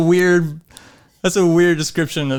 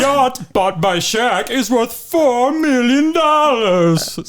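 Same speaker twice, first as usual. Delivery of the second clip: higher, slow mock TV-host voice; SNL TV host parody; 5 semitones higher, much slower than usual. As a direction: sell it like a cheesy celebrity-house show. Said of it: "I was trying to go for, like, the Saturday Night Live".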